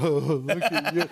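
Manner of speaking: teasing mock-impressed voice; Peyton Manning. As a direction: put on an amused, goofy coo. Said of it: "He's going".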